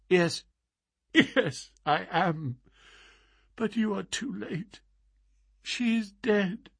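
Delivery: high, soft, faltering grief-stricken voice; dazed grief. Acted. Bereaved young man to strangers at her deathbed, dazed and grieving. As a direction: answer weakly through grief, with halting pauses. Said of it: "The man was so dazed".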